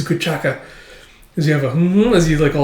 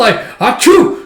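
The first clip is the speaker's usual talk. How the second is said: sneezing mid-word; 10 semitones higher, 6 dB louder than usual. Retold or imitated. Gas makes them sneeze.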